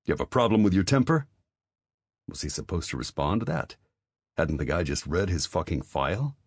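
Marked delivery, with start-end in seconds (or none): 0.1-1.3 fast, high, pressing question; grilling. A narrator's reading. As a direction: press him, quick and sharp. Major grilling the private.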